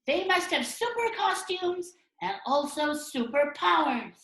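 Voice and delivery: very high, loud, drawn-out cartoon voices; animated comic characters. Characters argue over what makes superheroes, high animated squabbling.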